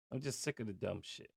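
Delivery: very soft and quick, just muttered; barely voiced, fast and fed up; quietly fed up. Real, not acted. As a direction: throw it away under the breath. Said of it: "Let me calm down".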